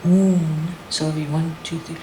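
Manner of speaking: chanted call-and-response poem; spoken-word poet leading a crowd chant; poem performance. Acted. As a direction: lead the room through the chant. Leads audience through chanted poem.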